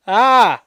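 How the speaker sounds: loud high mock scream; hammed-up loud screech.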